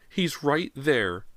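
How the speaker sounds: high, slow shriek. A narrator's reading. Mother shrieking, pointing.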